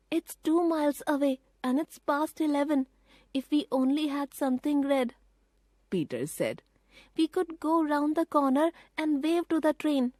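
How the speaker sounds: put-on a boy realising the danger; boy Peter, urgent. A narrator's reading. Peter warning his sisters about the train, urgent.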